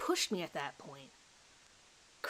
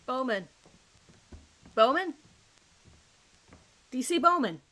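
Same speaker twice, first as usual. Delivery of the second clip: loud, urgent call for a missing man; alarmed calling out; 4 semitones higher, 5 dB louder than usual. Acted. Officer calling out for his vanished colleague, loud and alarmed. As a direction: shout his name, alarmed.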